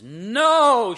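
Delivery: loud, high booming shout. Retold or imitated. Nun cuts off the cheering crowd with a booming shout. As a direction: silence the cheering crowd with one word. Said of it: "in her booming voice".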